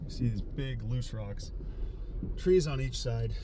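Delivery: breathless after running down trail; winded, catching breath between words. Real, not acted. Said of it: "I'm a little out of breath".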